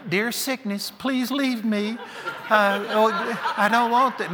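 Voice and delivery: mock a weak believer begging sickness; lightly mocking a timid churchgoer pleading with sickness. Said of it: "Some of you are saying".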